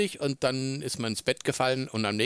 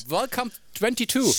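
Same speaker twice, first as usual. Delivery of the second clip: mock cheer; 11 semitones higher, 3 dB louder than usual. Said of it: "Dachte, ja".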